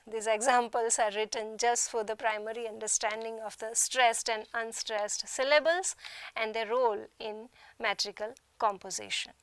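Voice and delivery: ordinary delivery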